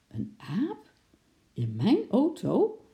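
very high, astonished questions; can't believe it; incredulous. Acted. Man hears a monkey is in his car, high astonished. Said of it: "keek de veldwachter ongelovig aan".

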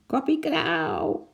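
a slow, high, squawking parrot voice; parrot call. A narrator's reading. Awakened parrot calling out in the night zoo, a high, slow squawk. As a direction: screech it out like a parrot. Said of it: "een papegaai die wakker was geworden riep".

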